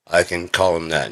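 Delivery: normal talking voice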